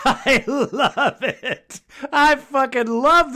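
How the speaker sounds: high, real laughter through the words; delighted laughing. Real, not acted. Host reacting to a caller's rant he loves, delighted laughing.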